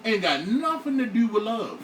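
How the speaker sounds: ordinary talk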